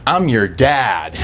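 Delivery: slow, low deadpan reveal; slow low deadpan dad. Retold or imitated. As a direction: drop it slow and heavy. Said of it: "he goes".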